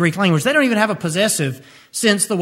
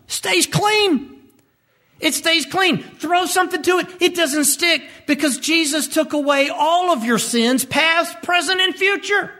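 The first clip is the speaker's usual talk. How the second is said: pitched higher, rising with joy; excited; 9 semitones higher than usual. Real, not acted. Preacher to congregation, exultant that the slate stays clean. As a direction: lift it up, jubilant and high.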